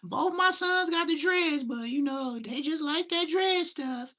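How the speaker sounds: high, slow church-lady voice of his mother; high, slow imitation of a church mom; imitating mom. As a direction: do his mama, sweet and slow. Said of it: "she gonna be like".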